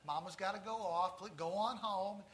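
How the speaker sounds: soft, kindly coaxing; nice and softly, as to a little dog; gentle cajoling. Retold or imitated. Elderly lady to her little dog, gently cajoling. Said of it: "cajoling the dog gently".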